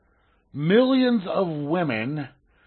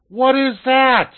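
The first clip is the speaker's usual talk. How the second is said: higher, louder, slow puzzled question; 8 semitones higher, 8 dB louder, slower than usual. Retold or imitated. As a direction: ask it like a baffled woman at the mirror. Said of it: "look in the mirror and ask".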